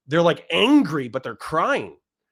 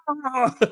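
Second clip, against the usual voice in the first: laugh; 10 semitones higher than usual. Real, not acted. Laughing at the memory.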